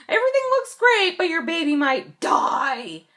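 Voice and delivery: high, loud mock-cheerful imitation. Patient parodying doctor's tactless news, mocking cheer. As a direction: sing out bad news in an exaggerated cheery voice.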